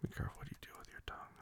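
fast hushed warning; whispering. Acted.

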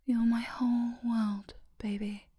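normal talking voice

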